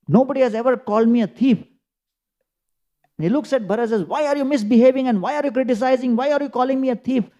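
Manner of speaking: fast, high and indignant; bewildered outrage. Acted. Sage protests to Bharat, bewildered and indignant. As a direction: protest in quick disbelief.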